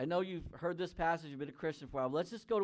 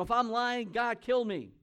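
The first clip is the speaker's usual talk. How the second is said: loud, high, vehement oath; cursing himself; 8 semitones higher, 9 dB louder than usual. Acted. Preacher acts out Peter denying Jesus, vehement raised-voice oath. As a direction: swear it forcefully, voice raised and pitched up. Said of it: "he called a curse on himself".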